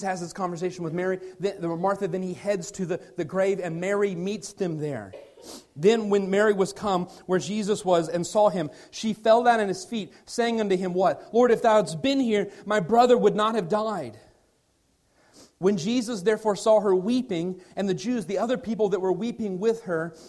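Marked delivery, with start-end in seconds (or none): 5.7-17.6 louder, ringing King James reading; scripture read with force. Acted. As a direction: proclaim the verses to the room. Reads scripture aloud with force.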